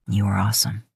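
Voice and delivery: low breathy whisper. A narrator's reading. Man whispers praise to his lover, breathy whisper. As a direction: whisper it against her lips.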